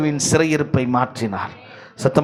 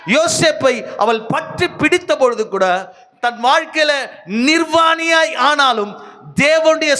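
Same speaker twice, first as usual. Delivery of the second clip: full-force preaching; 11 semitones higher, 4 dB louder than usual. Real, not acted. Preacher telling how Joseph fled temptation, high and forceful. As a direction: proclaim it with raised pitch, stretching key words.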